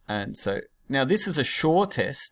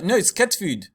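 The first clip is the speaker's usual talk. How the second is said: put-on higher South African accent, lightly done; 7 semitones higher, 4 dB louder than usual. Teacher voicing Vickers' reply in South African accent. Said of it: "trying to do the South African accent".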